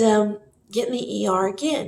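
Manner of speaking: ordinary talk, nothing marked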